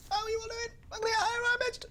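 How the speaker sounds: very high, crying falsetto voice; squeaky falsetto bit. Pretend. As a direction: squeak it out in a silly high cry.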